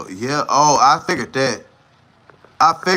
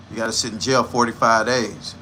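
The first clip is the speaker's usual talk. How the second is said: mock judge; 9 semitones lower than usual. Acting the judge's answer.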